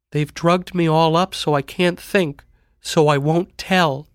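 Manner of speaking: put-on high-pitched, drugged girl pleading; anxious, high girlish voice; troubled sister. A narrator's reading. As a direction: confide a paranoid fear to your brother.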